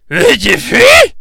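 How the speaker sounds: high, loud disbelief; shocked. Acted. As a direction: squawk in outrage. Device answers unexpectedly.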